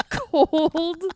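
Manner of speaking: high, slow, laughing emphasis. Real, not acted. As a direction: drag each word out laughing. Mock-grave listing, laughing.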